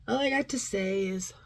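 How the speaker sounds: normal talking voice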